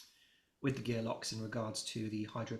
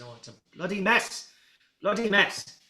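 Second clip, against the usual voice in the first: angry outburst; 8 semitones higher, 9 dB louder than usual. Acted. Dockmaster rapping knuckles, fuming.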